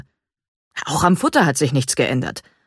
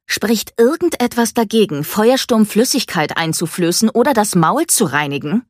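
higher, louder angry challenge; angry girl squaring up to the vet; 6 semitones higher, 5 dB louder than usual. A narrator's reading. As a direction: confront the vet, furious. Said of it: "Wütend baute sich Mika vor dem Tierarzt auf".

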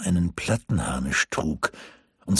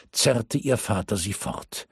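ordinary delivery

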